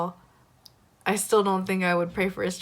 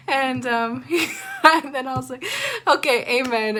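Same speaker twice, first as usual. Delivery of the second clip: nervous laughter; 7 semitones higher, 5 dB louder than usual. Real, not acted. Embarrassed after awkward prayer.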